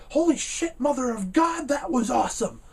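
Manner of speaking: high-pitched, excited commentator outburst; Don West, very excited. Retold or imitated. Commentator calling an amazing wrestling move, wildly excited. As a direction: erupt over the huge move, pitch soaring. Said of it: "a very excited commentator".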